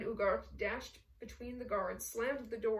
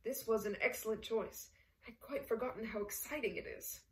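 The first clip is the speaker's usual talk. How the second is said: ordinary delivery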